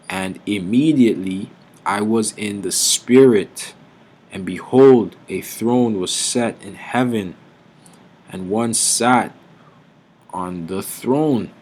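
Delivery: loud, slow, booming scripture; preaching at full force. Acted. Preacher thundering scripture to his flock, booming and slow. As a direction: proclaim each phrase like a herald.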